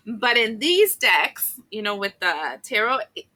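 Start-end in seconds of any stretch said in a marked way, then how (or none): none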